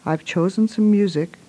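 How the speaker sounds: usual speaking voice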